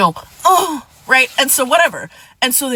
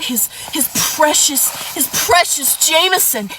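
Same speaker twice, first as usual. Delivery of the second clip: sarcastic mockery; 6 semitones higher than usual. Real, not acted. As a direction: stretch "precious" with mock reverence. Mocking ex-friend's fondness for Jameson.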